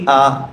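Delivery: a clear open আ sound; teacher sounding out the vowel; vowel sound demo. Shown as a demonstration. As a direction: say আ plainly and held. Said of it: "ফাতহার উচ্চারণ কি?".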